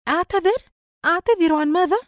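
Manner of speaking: very high, loud, incredulous questions; utter astonishment. A narrator's reading. Sister asked by brother to apologise, utterly astonished. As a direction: exclaim in outraged disbelief. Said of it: "وقالت بتعجب شديد".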